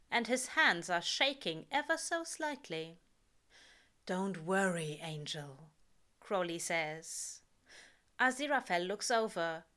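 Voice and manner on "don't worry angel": calm a nervous friend; reassuring. A narrator's reading. Crowley reassures.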